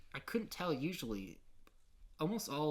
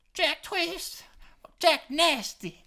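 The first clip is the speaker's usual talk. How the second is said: high, loud, slow woman's voice; shrieking the names high; 10 dB louder, much slower than usual. Retold or imitated.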